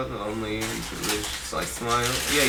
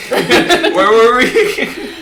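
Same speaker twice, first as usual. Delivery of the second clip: laughing high repeat; 9 semitones higher, 8 dB louder, much slower than usual. Real, not acted.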